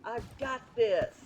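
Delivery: pumped about clearing a level; excited game cheer. Real, not acted. Aside while playing a game.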